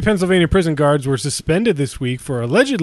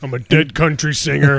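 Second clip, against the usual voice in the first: [name] voice. Pretend. Continuing the [name] bit.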